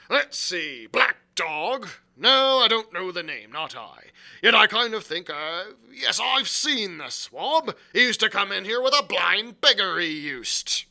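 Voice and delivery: loud, high musing; aloud. A narrator's reading. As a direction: think out loud for all to hear. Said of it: "he ran on again aloud".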